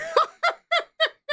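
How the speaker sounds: high, helpless laughing fit; burst of laughter. Real, not acted. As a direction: crack up at a joke. Laughs at the funny comment.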